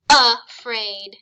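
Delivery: "afraid" loudly split as uh, fraid; schwa pronunciation. Shown as a demonstration. Teacher sounding out next word, "afraid" with schwa. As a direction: start "afraid" with a distinct short uh. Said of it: "Using our schwa sound".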